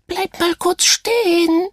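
high, piping little voice; squeaky little starfish. Acted. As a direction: pipe it up high like a tiny creature. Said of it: "piepste der kleine Seestern".

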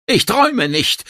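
high-pitched yelled retort; Zurückschreien. A narrator's reading. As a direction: yell back in protest, pitch jumping up. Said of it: "schrie sie zurück". (hoch zurückgeschrien)

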